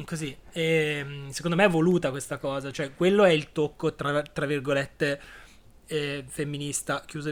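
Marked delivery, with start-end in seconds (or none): none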